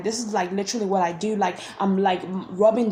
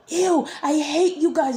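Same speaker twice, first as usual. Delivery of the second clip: higher, slow disgusted "ew", a retold dream outburst; disgusted retort; 7 semitones higher, 3 dB louder, slower than usual. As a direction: recoil and spit it out. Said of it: "and I was like".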